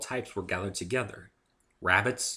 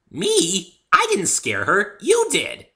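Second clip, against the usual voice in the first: high, loud, bouncy pony voice; high loud cheerful pony girl; bubbly Pinkie; 13 semitones higher, 12 dB louder, much slower than usual. A narrator's reading. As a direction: chirp it like an excitable pony.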